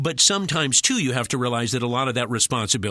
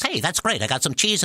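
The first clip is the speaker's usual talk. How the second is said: lightly voiced rat, pitched up; a touch of cartoon rat, higher; 6 semitones higher than usual. Voice actor auditioning as a rat, light character voice. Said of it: "let's say the guy all of a sudden is talking like this".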